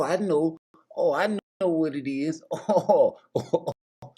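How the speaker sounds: ordinary delivery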